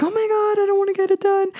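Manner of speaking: high, frightened mock mom; panicked mom. Mom scared off the test after dad's fake faint, high panic. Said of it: "my mom's like".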